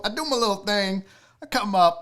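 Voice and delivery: ordinary delivery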